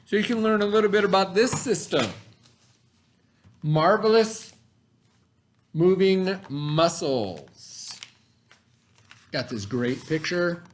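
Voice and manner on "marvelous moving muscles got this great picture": put-on clear, deliberate read-aloud voice for kids; reading to children. Teacher reads the science book to her students, storytime voice. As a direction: read the book slowly to the class.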